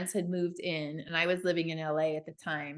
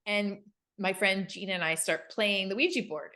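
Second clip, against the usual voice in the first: normal talking voice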